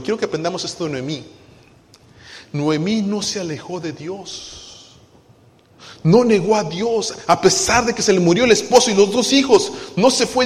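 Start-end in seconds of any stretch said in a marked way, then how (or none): 6.0-10.4 raised, driving preacher voice; preaching at full force. Acted. Pastor preaching high and loud to the congregation.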